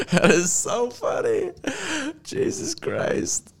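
high squeaky voice through laughter; barely getting words out while laughing; wheezing with laughter. Real, not acted. Laughing at a fashion photo.

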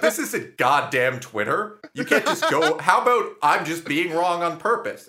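hammed-up indignation at a meddling stranger; aggressive retort. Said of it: "that was really aggressive".

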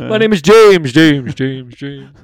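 name repeated, fading like an echo; loud then dying away, echoing; cave echo. Pretend. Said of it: "The dumbest caveman shouting into a cave".

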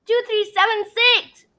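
high, bright cheer; triumphant call of numbers. A narrator's reading. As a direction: unicorn cheering the final code. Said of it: "Gemma cheered".

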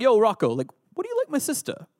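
a tough Philly guy asking about his sister; preacher imitating Paulie from Rocky. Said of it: "I'm gonna attempt to do the voices".

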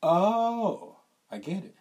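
high startled "oh, oh" as it clicks; dawning realization. Retold or imitated. Realizing the girl's hint, a sudden high "oh". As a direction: let it dawn on you, pitch jumping up. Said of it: "So I was like".

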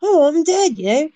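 high-pitched mock Australian accent; Crocodile Dundee. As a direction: whine it in a hammed-up Aussie accent. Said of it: "I don't know why everyone has this accent".

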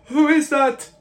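high, loud questioning call; high-pitched, urgent challenge; calling prisoner. Acted. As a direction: call back from the cell. GM voices prisoner answering a call.

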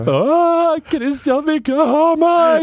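high, loud, slow hammed-up wail; mock wailing. Mocking friend crying behind a bin, hammed-up wail. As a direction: wail it like a heartbroken drama queen. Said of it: "der du gråter og sier".

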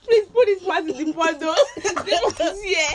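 amused, high and giggly; laughing request. Real, not acted. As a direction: plead while cracking up. Cracking up at the slip.

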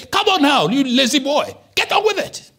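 loud, high scolding father. Retold or imitated. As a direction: chide a lazy boy. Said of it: "My father would say".

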